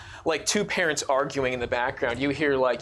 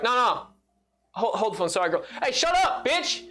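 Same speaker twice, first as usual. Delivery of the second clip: Ara snapping; 8 semitones higher than usual. Acted. Ara on the phone yelling at Larissa in background. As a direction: cut off the call and snap at someone behind you. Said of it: "Ara be like".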